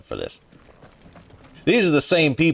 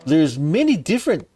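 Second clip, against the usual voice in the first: mock British; 6 semitones higher, 3 dB louder than usual. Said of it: "they're like".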